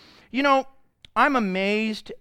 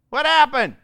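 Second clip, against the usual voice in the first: shouted question, higher and louder; loud call to the crowd; 6 semitones higher, 5 dB louder than usual. Shown as a demonstration. Preacher urging the church to answer loudly. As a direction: call out to the church to yell back. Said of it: "Yell at me".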